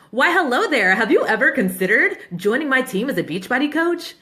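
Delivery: over-polite fake recruiter voice; awkward salesy pitch. Shown as a demonstration. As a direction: read it like a stiff canned message. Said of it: "I change my voice".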